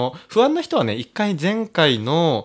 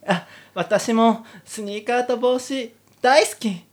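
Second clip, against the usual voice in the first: put-on higher, girlish heroine voice, dreamy then gushing; giddy heroine; 9 semitones higher, slower than usual. Heroine swooning over the soldier, giddy high voice. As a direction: play the lovestruck girl.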